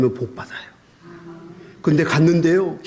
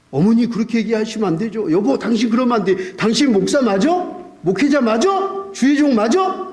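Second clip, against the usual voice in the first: wife's rebuke; 5 semitones higher than usual. Retold or imitated. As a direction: scold the husband who forgets he is a pastor. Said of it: "이렇게 얘기하더라고요".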